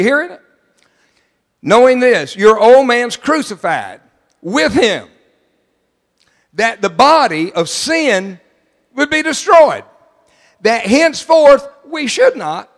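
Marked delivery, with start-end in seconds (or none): 1.6-12.7 loud, higher, slowed and stretched; preaching the verse. Acted. Preacher rereading the verse to the church, loud and stretched. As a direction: thunder the verse at them.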